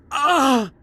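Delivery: sudden high pained yelp; loud pained shout; sharp cry of pain. Acted. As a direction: gasp out as the wound hurts. Cries out in pain.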